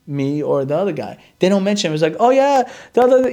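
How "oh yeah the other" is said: chirpy receptionist. Retold or imitated. Imitating receptionist pushing other doctor.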